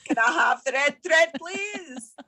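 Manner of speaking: mock-Scottish husband asking his wife; Scottish accent. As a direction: call out like a comic Scotsman. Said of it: "Is that your Scottish accent?".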